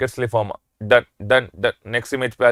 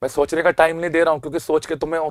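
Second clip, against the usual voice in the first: ordinary talk, nothing marked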